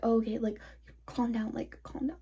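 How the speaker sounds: soft imitation of a peacemaker, trailing to a murmur; hushed calming. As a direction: quietly mimic someone settling things down. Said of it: "de-escalate everything".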